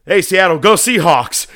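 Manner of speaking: loud, high call to the arena; crowd-pleasing shout. Retold or imitated. Wrestler covers his swearing with a hometown cheer, loud shout. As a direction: holler it out to a whole crowd. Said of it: "and he just goes".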